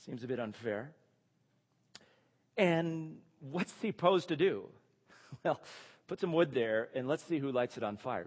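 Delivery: ordinary talk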